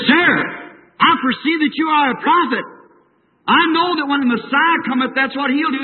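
high, pleading, urgent cry; desperate woman crying out to the prophet; desperate plea. A narrator's reading. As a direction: beg him as if your life hangs on it. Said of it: "Then she got desperate".

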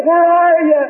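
high, loud calls for his wife, searching in panic; frantic screaming. Retold or imitated. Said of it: "And I began to scream".

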